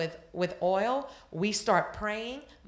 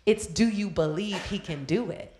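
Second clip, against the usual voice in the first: ordinary delivery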